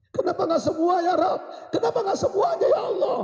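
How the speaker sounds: very high, loud wail to God; dying man crying out at full force; anguished shout. Acted. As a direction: escalate into a desperate scream of regret. Dying husband's repeated cry of regret.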